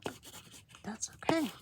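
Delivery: ordinary talk, nothing marked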